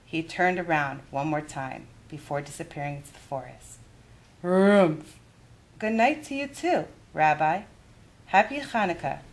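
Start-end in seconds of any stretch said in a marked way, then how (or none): 4.4-5.2 a louder sleepy bear grunt. Acted. 5.8-9.2 a higher, lively grandmother voice; kindly old granny offering a game; Baba Bryna's voice. Acted. Baba says goodnight.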